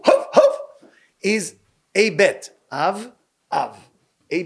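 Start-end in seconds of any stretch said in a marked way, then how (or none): 0.0-0.6 high yapping dog barks again. Shown as a demonstration. Rabbi linking the Hebrew word to barking, high yapping. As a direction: yap it like a little dog. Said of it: "The word in Hebrew".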